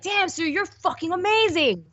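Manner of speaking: put-on high, gushing drunk praise; hammed-up drunk compliment; drunk friend. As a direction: gush like a tipsy karaoke buddy. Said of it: "if you were, like, drunk".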